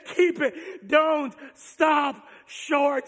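God shouting encouragement to the weary; God urging. Acted. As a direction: drive every word home. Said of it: "And he says".

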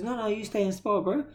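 high, eager coach voice; light mock of an insistent coach; imitating the coach.

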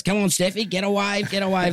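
high-pitched imitation of Mum urging a surfer on; mock excited armchair coaching; cheering on. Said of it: "She tells them what to do".

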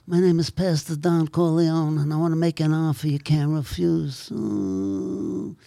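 a raspy mobster Don Corleone; Godfather impression. Shown as a demonstration. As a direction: play the Godfather making an offer. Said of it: "I do The Godfather".

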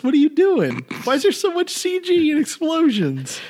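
high, exasperated disbelief; incredulous rant. Real, not acted. Mocking the silly submarine film to cohost, high and exasperated. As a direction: throw up your hands at the movie.

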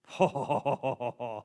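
low, falling chuckle; awed laugh. Real, not acted. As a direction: let out a low run of chuckles. Moved by his own story.

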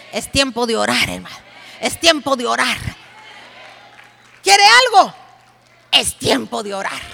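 loud, driving call to prayer; rally the congregation, pushing them; preaching at full force. Acted. Preacher rousing the sisters to pray, shouting.

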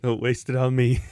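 laughing through the words. Real, not acted. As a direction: chuckle while agreeing. Joining the joke.

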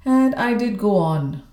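ordinary delivery

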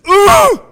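high, very loud drawn-out grunt; triumphant loud grunt. Acted. Shy student proving they can do it, loud grunt. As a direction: grunt with all your might. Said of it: "comes up to you and grunts".

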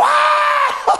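very high sharp cry of pain; a little girl yelping as the wire bites; child's sudden scream. Retold or imitated. Daughter touches electric fence.

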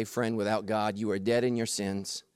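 usual speaking voice

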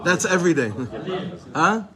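high and loud, playful after a laugh; amused surprise. Real, not acted. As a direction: toss it back at the class with a laughing jab. Teasing the class, laughing.

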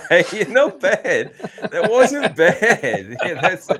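high, loud, laughing approval; drawn-out words bursting through laughter; laughing praise. Real, not acted. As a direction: crack up while praising their attempt. Amused praise for the accent attempt.

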